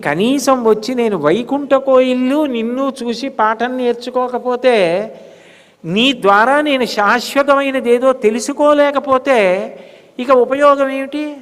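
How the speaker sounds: high, sustained devotional pitch; impassioned, high-pitched exhortation; fervent preaching. Acted. Sermon rises to fervent pitch.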